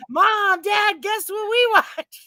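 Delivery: high, drawn-out call to parents; high-pitched schoolkid announcement; excited kid. Retold or imitated. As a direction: play a child bursting in with news. Acts child coming home from school.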